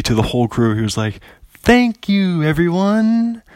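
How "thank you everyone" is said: high, grand Hollywood thank-you; showbiz thank-you. Retold or imitated. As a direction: play it like a showman waving to the crew. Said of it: "like, Hollywood, like, wave".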